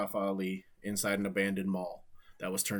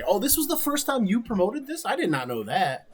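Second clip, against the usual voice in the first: much higher, louder burst of astonishment; real surprise; 10 semitones higher, 7 dB louder than usual. Real, not acted. Streamer learning it was the promoter's first show, genuinely surprised. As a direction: react with loud, high-pitched disbelief.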